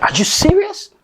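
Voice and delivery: high, incredulous outcry; a father's shocked high protest at the wasted day; stunned disbelief. Acted. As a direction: cannot believe his ears. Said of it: "the father looks at him like".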